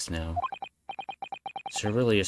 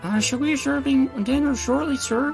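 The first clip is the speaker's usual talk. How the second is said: much higher meek maid voice; timid maid voice; 5 dB louder than usual. Acted. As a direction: squeak it out like a nervous maid. Maid voiced by same speaker.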